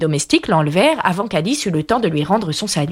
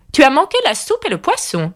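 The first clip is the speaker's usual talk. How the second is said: high-pitched haughty queen; imperious queen; 8 semitones higher than usual. A narrator's reading. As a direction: pronounce it like a queen in command. Red Queen gives orders at dinner. (reine autoritaire, voix haut perchée)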